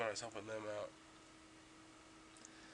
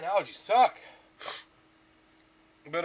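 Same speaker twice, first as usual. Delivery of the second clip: normal talking voice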